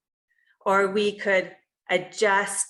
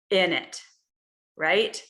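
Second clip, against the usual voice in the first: usual speaking voice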